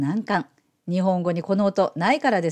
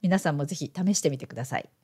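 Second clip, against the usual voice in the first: normal talking voice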